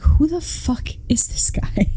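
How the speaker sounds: whispered, slow and baffled. Retold or imitated. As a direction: hush it out in disbelief. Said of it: "and I was like".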